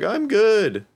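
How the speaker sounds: strained high happy act; mock forced cheer. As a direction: parody someone about to cry insisting they're great. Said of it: "they're like".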